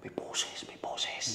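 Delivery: hushed scared whisper; frightened whisper about a voice. Real, not acted. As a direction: whisper it so nobody hears. Hearing a voice, hushed.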